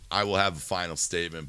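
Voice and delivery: normal talking voice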